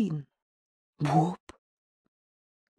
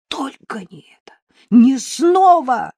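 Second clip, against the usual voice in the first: horrified plea; 5 semitones higher, 11 dB louder than usual. A narrator's reading. Woman facing a knife, horrified plea. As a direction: plead in horror, building to a cry.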